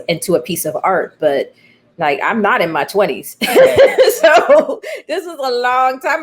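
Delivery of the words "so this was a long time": laughing. Real, not acted. Laughs about her age.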